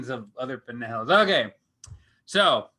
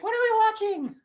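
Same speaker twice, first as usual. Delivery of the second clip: put-on much higher, squeaky kid voice; imitating daughter; much faster than usual. Dad imitates his daughter Skippy asking, squeaky high kid voice. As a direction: imitate a little girl asking, pitched way up. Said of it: "Skippy, she was like".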